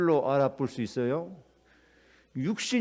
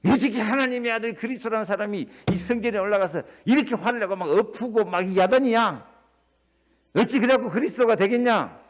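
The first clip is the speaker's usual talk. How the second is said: higher, louder scandalised critic; mock outrage; 5 semitones higher, 5 dB louder than usual. Preacher imitating scoffers at the temple scene, outraged. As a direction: sneer like a doubter.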